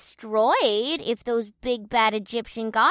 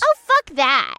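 loud, defiant snap; indignant outburst; 2 semitones higher, 7 dB louder than usual. A narrator's reading. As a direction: brush it off in outrage. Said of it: "said with a shake of her finger".

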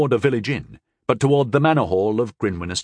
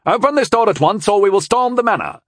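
loud, high command; demanding entry, raised voice; commanding threat; 10 semitones higher, 4 dB louder than usual. A narrator's reading. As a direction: bark the order at the gate. Ordering the gate opened.

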